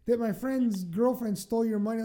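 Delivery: normal talking voice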